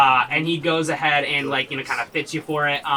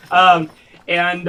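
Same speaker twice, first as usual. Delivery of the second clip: ordinary talk, nothing marked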